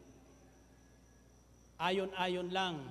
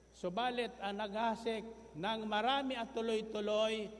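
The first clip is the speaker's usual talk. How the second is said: full-force preaching; 3 semitones higher than usual. Acted. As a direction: drive the point home to the congregation.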